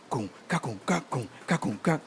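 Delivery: soft vocalised Pocomania drum rhythm; beatbox the drum quietly, kung ka. Retold or imitated. Imitating the drum for the podcast hosts, softly. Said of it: "started playing a Pocomania drum".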